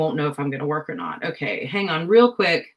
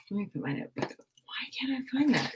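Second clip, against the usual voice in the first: whispered; 6 semitones higher, 9 dB quieter, faster than usual. Real, not acted. Muttering while searching.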